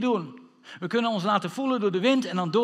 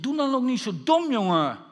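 high-starting scold that drops away; exasperated scolding; 5 semitones higher, 4 dB louder than usual. Acted. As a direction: tell someone off in exasperation. Voices an exasperated onlooker scolding. (geërgerd uitvallen)

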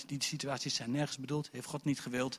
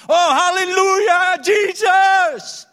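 huge high hallelujah cry, drawn out; exultant shout; 18 dB louder, much slower than usual. Acted. Judas out in front praising Jesus, exultant shout. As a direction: shout praise slowly at full voice.

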